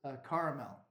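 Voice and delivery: "caramel" as CAR-uh-mel, three syllables; pretentious "caramel", ending in a full MEL; pretentious pronunciation. Shown as a demonstration. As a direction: say "caramel" grandly with a "car" opening. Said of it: "If I wanna be pretentious".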